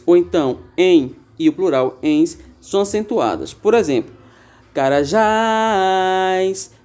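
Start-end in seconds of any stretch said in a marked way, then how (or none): 4.7-6.7 carajás stretched very slowly, weight on jás; teacher spelling out carajás slowly; drawn-out carajás. Shown as a demonstration. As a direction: draw out carajás and lean on jás. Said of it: "Repare que jás é a palavra tônica".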